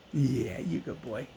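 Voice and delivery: soft, slow pet talk; talking to the dog. Real, not acted. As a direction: coo softly to a good dog. Praising the dog Loki.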